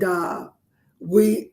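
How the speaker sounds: usual speaking voice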